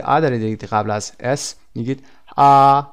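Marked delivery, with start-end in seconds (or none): 2.4-2.8 "ا" held long and loud; voiced vowel sound. Shown as a demonstration. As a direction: sustain the vowel so the throat vibrates. (صدای «ا» بلند و کشیده با لرزش گلو)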